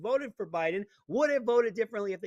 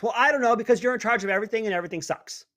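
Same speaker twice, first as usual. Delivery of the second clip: ordinary talk, nothing marked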